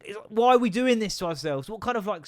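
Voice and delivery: usual speaking voice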